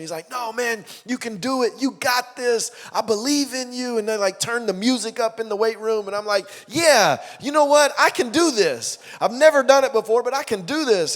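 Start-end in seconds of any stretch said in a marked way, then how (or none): 0.3-4.0 higher, eager coach encouragement; cheering coach voice; hyped-up coach. Retold or imitated. 6.7-11.2 loud, high self-hype; psyched up. Retold or imitated. Self-psyching before lift.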